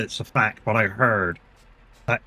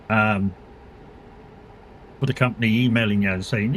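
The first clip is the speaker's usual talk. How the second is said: ordinary talk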